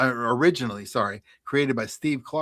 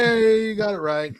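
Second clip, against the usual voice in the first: raised celebratory call; mock cheering; 6 semitones higher, 4 dB louder than usual. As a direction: cheer the contestant with a long yay. Host cheers correct answer.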